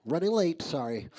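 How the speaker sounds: lightly put-on code-switched office voice; work voice. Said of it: "We get to work and like".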